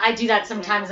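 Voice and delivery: normal talking voice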